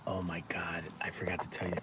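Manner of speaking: hushed secret whisper, breathy and unvoiced; sudden whisper so strangers can't overhear. Real, not acted. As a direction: whisper it so nobody nearby hears. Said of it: "I don't know why I'm whispering".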